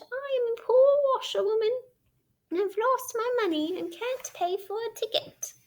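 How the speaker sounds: high, put-on tearful washerwoman voice; crying washerwoman. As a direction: plead like a teary poor washerwoman. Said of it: "crying afresh".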